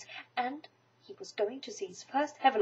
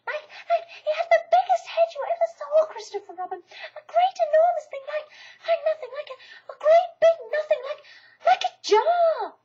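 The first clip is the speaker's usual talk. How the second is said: high, loud, stammering in fright; flustered Piglet; 8 dB louder than usual. Acted. Piglet describing the Heatherlump to Christopher Robin, flustered and afraid. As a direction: grope for words, frightened and excited.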